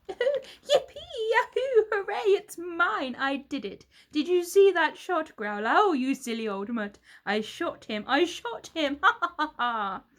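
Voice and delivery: high, loud gloating cheers; gleeful cheering. A narrator's reading. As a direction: whoop like a cruel winner. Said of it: "The hunter screamed and cheered".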